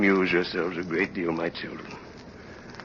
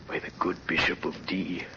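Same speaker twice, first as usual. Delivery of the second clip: reverent whisper; 2 semitones lower, 6 dB quieter than usual. Acted. Dying Jean Valjean names the bishop in a hushed whisper.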